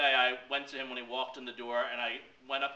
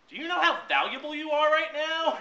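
excited; 10 dB louder than usual. Retold or imitated. Mentor excited about experience.

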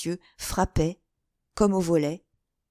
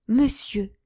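higher, louder imploring cry; pleading; 6 semitones higher, 5 dB louder than usual. A narrator's reading. Young woman begging the gentleman to leave, pleading. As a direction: beg him with the word. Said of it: "suppliant et joignant les mains".

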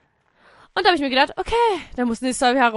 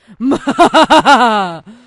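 loud villain cackle; hammed-up evil laugh; 13 dB louder than usual. Villain gloating in level.

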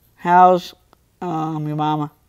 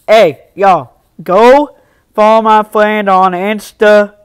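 calling out; 2 semitones higher, 7 dB louder, slower than usual. Real, not acted. Calling out to stream viewers.

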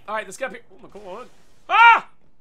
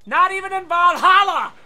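heroic shout; 6 semitones higher, 9 dB louder than usual. Acted. Mock-heroic vow in game.

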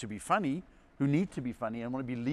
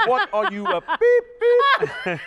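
mocking dad; 12 dB louder, much slower than usual. Dad mocking son wanting acting.

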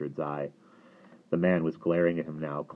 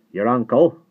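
loud, higher questioning burst; sharp query; 6 semitones higher, 12 dB louder than usual. A narrator's reading. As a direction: fire it back as a challenge. Suspicious farmer questions the boy.

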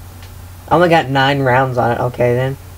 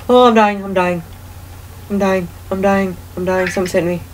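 rising panic, higher pitch; panicked; 6 semitones higher than usual. Real, not acted. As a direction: panic as the health drains. Being killed in game.

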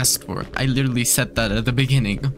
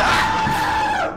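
terrified scream. Real, not acted. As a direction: scream your lungs out in terror. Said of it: "I have never screamed like that".

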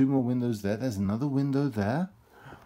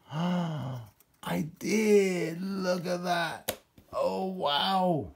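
delighted gasp; 6 semitones higher, much slower than usual. Real, not acted. Finding the bird piece.

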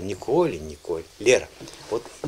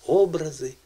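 "образи" with the О high and strong; "образи" as Образи, О stressed; stress on first syllable; 3 semitones higher than usual. Shown as a demonstration. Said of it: "наголос ми поставили".

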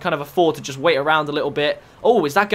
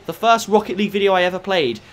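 normal talking voice

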